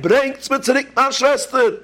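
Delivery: high desperate cry for his sister; boy screaming. Retold or imitated. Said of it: "Er hat umgegangen schreien".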